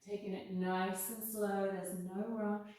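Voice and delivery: unhurried, calm guidance; careful unhurried voice. Acted. Guides breathing in cat cows.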